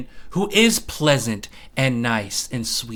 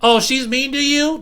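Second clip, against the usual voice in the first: mocking quote; 11 semitones higher, 4 dB louder than usual. Mocking what women tell boys.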